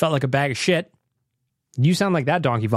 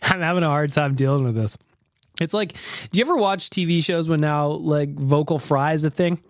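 usual speaking voice

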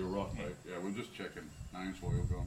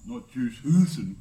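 mock emphatic correction; 10 dB louder, slower than usual. Correcting the name mid-joke.

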